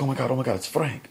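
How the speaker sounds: high, alarmed retelling; mock panic. Woman hearing Frank at the door, mock panicked.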